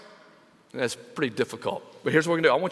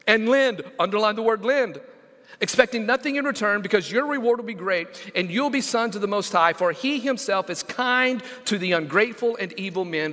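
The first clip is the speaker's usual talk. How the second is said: scripture reading; 5 semitones higher, 3 dB louder than usual. Acted.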